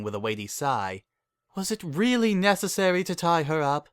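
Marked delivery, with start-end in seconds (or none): 1.5-3.9 breezy dismissal in a posh-pony voice; Rarity, dismissive. A narrator's reading.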